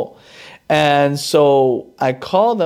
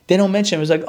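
normal talking voice

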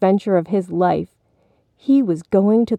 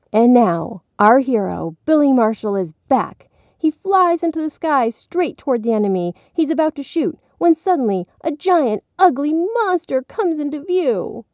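high, excited play-by-play; boy playing announcer; 7 semitones higher than usual. A narrator's reading. Billy narrating his hero game, excitedly theatrical. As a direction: build the drama of a make-believe adventure.

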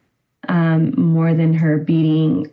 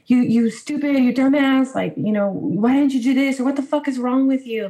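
exasperated, raised-pitch retelling of insults; retold parental yelling; 7 semitones higher than usual. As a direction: imitate parents cussing out their kid, mildly. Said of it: "they can't have a conversation without yelling".

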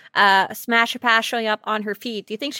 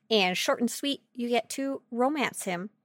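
ordinary talk, nothing marked

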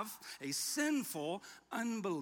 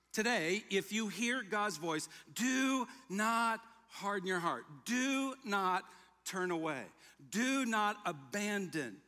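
raised, pressing warning, each DO leaned on; emphatic warning; 3 semitones higher than usual. Acted. Preacher urging his congregation, emphatic and raised. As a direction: plead with them not to turn away. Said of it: "He's emphatic about this".

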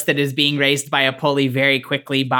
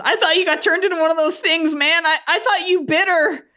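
put-on high-pitched, fast kid voice; anxious teenager. Tyler confronts his dad about a bite, high and hurried. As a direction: blurt it out in a squeaky high voice. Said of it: "Tyler hugs his dad and says".

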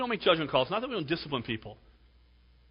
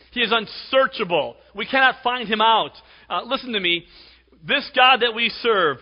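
raised, loud preacher's voice; high, forceful pulpit delivery; full-force preaching; 8 semitones higher, 10 dB louder than usual. Real, not acted. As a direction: drive it home to the congregation loudly.

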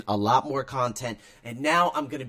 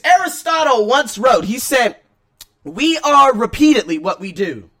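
loud, raised reading voice; book reading; 6 semitones higher, 9 dB louder than usual. Acted. Podcaster reading the book chapter aloud to listeners. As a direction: read the passage out with energy.

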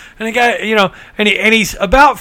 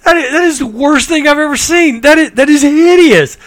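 higher, appalled doctor voice; doctor recoiling at the sight, higher and appalled; horrified doctor; 7 semitones higher, 3 dB louder than usual. Retold or imitated. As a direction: recoil from the worst thing he's seen. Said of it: "he says".

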